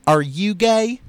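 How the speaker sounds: a Mississippi mother asking; Mississippi accent. Acted. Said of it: "how's my Mississippi accent".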